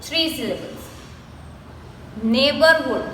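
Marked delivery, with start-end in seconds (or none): none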